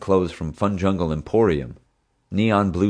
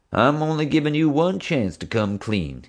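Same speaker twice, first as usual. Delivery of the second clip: stern cop voice, pitched up; warning tough cop; 9 semitones higher than usual. A narrator's reading. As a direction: lean in and warn him hard. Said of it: "he warned Hank".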